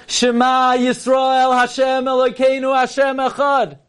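high, slow, shouted declaration; a father's overwhelmed outcry, high and slow; crying out Shema. Retold or imitated. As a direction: cry it out to heaven, drawn out. Father cries out Shema on the spot.

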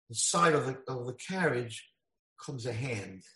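ordinary delivery

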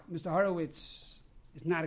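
normal talking voice

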